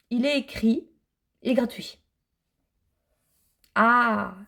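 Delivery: ordinary delivery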